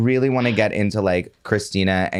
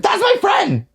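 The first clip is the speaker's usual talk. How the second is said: much higher, excited exclaim; 4 dB louder than usual. Retold or imitated. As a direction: blurt it out thrilled. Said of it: "I was like".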